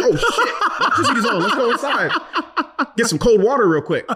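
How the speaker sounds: high, laughing, eager rush; excited. Retold or imitated. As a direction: hurry inside, thrilled. Excited kid when power returns.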